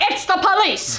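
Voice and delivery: a sister imitating her brother's fake police shout; brother playing cop. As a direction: pound out the cop line like a prank. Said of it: "And I hear".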